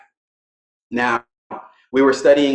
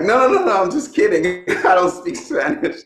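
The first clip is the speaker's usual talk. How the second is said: flustered backpedal; 8 semitones higher than usual. Retold or imitated. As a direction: hurry to take the joke back, lightly amused. Backpedalling his joke to wife.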